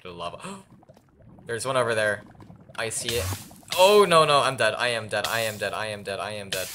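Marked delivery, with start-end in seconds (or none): 3.8-6.7 loud panic fading to resignation; panicking. Real, not acted. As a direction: panic that sinks to giving up. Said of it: "I was desperately panicking".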